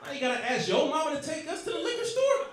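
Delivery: put-on very high, squeaky little kid voice, laughing; a toddler roasting his dad; four-year-old voice. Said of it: "my four-year-old looking up at me like".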